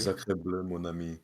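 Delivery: mock French chef voice; playful French-accented exclamation. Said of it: "Is he French?".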